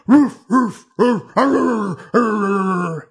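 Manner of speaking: loud gruff dog barks; barking dog. Acted. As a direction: bark like a bigger dog.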